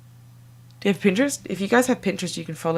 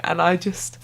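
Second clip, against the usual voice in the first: ordinary talk, nothing marked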